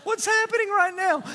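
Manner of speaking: mounting high-pitched exasperation, complaint piled on complaint; frustrated. Retold or imitated. Runner retelling his mid-race meltdown, frustrated and climbing. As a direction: build a hammed-up rant to a high wail at the end. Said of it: "I was so frustrated in that moment".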